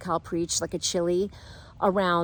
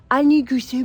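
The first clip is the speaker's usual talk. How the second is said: higher mock jumbled words; garbled attempt; 4 semitones higher, 5 dB louder than usual. Nervous man's third try at talking, imitated garble. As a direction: fumble the words, imitating a tongue-tied man. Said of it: "He could not get words out".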